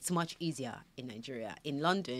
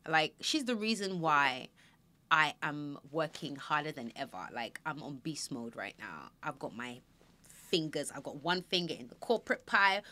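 ordinary delivery

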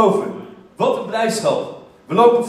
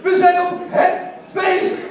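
high excited disbelief; thrilled exclaim; 9 semitones higher than usual. Retold or imitated. Friends realizing they crashed the team party, high excited cry. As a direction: squeal it like you can't believe your luck.

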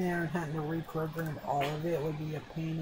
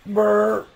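shivering brr; 3 semitones higher, 11 dB louder than usual. Acted. NPC cold noise.

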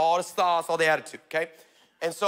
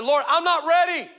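higher, louder, half-joking panic; panicked plea; 8 semitones higher, 4 dB louder than usual. Retold or imitated. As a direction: beg God in mock alarm. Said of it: "I said".

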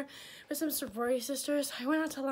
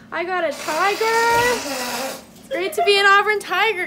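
loud, higher proud cheer; louder, higher Auburn pride yell; school cheer; 8 semitones higher, 12 dB louder than usual. Real, not acted. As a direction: shout it like a fan at the game.

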